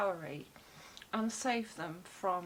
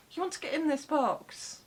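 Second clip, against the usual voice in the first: higher, coaxing question to the cat; pet talk; 6 semitones higher, 4 dB louder than usual. Real, not acted. Coaxing her cat into the box, high pet voice. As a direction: tempt the cat in with a lilt.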